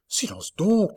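urgent whispered hush. Acted. Marguerite silencing la Mole with her hand. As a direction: whisper it sharply. Hushing him, hand on his mouth.

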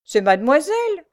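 high, loud indignant accusation; furious. A narrator's reading. Prisoner denounces Marianne to the sergeant, furious. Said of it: "d'un ton furieux".

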